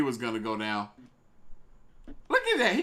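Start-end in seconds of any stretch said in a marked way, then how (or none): 2.3-2.8 excited squeal. Real, not acted. Reacting to clip.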